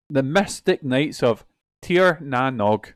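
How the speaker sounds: slowly sounding out each word; slow careful reading. Real, not acted. Reads unfamiliar Irish name.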